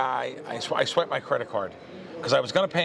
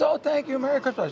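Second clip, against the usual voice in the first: kind lady; 13 semitones higher than usual. Retold or imitated. Kind lady thanking him.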